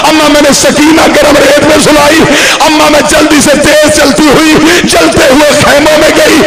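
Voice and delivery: high, loud wailing recitation, slowing at the end; wailing lament. Acted. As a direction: cry it out to the congregation at full force. Climax of the lament, the daughter's plea. (ऊँची आवाज़ में विलाप करते हुए)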